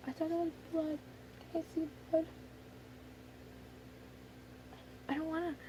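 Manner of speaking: quiet, reluctant little plea; scared. Pretend. Recalling the blood draw to viewers, scared. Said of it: "I'm scared".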